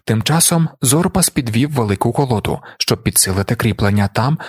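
ordinary delivery

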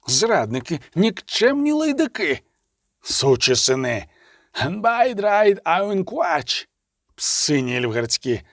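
drawn-out insults leaping up in pitch; enraged cursing. A narrator's reading. Betrayed elves curse imperial officers, angry and drawn-out. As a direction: hurl slow, stretched curses at the betrayers.

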